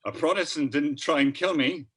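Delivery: loud, high mock-Irish lilt; Irish accent. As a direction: play a pleasantly surprised Irishman. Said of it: "you threw in an accent".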